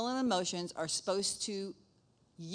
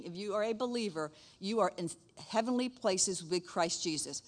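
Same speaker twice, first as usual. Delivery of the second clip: ordinary talk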